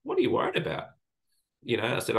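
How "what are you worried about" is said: sharply higher motherly voice, imitating mum; voicing mum asking kindly; mum's gentle question. Mum reassuring her crying son, higher voice.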